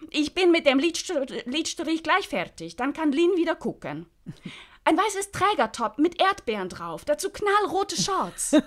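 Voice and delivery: high, loud and chirpy; high lively character voice. Acted. Describing the strawberry outfit, high and chirpy. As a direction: rattle it off brightly.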